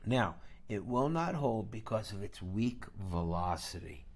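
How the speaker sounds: normal talking voice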